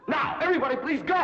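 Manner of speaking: loud, high-pitched dismissal; exasperated order. Acted. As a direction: shoo them all out at full voice. Teacher orders everyone out.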